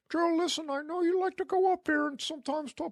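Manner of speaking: a hint of a cartoon alien as the nagging boss; Marty the Martian voice. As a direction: boss scolding the worker, a faint cartoon alien voice. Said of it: "He talked like Mar-, Marty the Martian".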